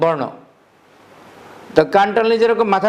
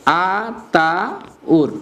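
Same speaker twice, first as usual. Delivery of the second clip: "Ataur" very slowly as আ, তা, উর; broken into syllables; much slower than usual. Shown as a demonstration. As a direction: stretch each piece of "Ataur", pausing between. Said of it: "এটা ভাঙাইতে পারো কিভাবে". ("আতাউর" টেনে টেনে আ, তা, উর করে ভেঙে)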